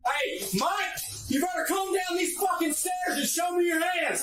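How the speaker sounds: man down shout. Real, not acted. Officer ordering armed suspect downstairs.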